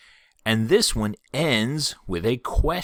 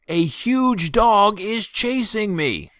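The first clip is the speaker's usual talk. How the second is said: much higher, slow, mock-panicked cry; hammed-up alarm at a chasing dog; mock fear; 12 semitones higher, 4 dB louder, slower than usual. Shown as a demonstration. Teacher acting out an exclamation of fear, mock-panicked. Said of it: "shows strong feeling like excitement, surprise, or fear".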